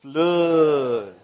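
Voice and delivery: Flood stretched long and low; a slow, drawled Texan "Flood". Shown as a demonstration. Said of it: "give me a good Texan flood".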